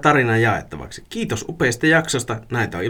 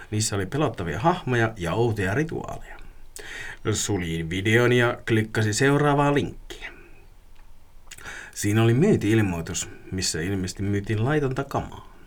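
measured, subdued read-aloud with pauses; creepy story narration; 4 dB quieter than usual. A narrator's reading. Reading a listener's dark web story, creepy narration. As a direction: unfold an eerie tale unhurriedly.

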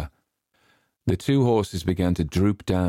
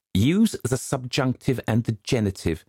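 prim correcting governess; 6 semitones higher than usual. A narrator's reading. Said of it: "she said".

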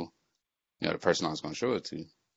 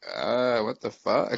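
retold disbelief; 5 semitones higher, 5 dB louder, slower than usual. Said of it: "And I was like".

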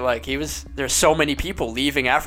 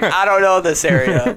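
nervous; 7 semitones higher, 7 dB louder than usual. Retold or imitated.

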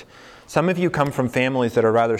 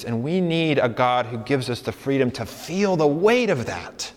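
normal talking voice

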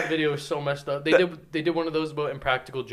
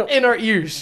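normal talking voice

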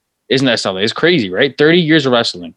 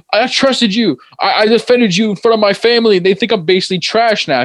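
higher, hurt, angry voice; mock Aaliyah; 7 semitones higher than usual. Aaliyah yelling at Murphy after the betrayal, higher voice.

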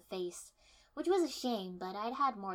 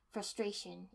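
ordinary delivery

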